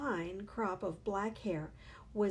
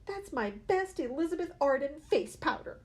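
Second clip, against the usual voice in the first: annoyed scolding; 6 semitones higher, 5 dB louder than usual. A narrator's reading. Mother scolding over spilled powder.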